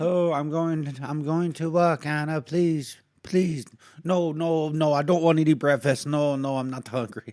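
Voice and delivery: put-on high, loud pleading in an unplaceable foreign accent; panicky-pitched mock accent, loudly refusing; hammed-up accent of no clear country; unidentified foreign accent. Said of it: "I don't know what accent".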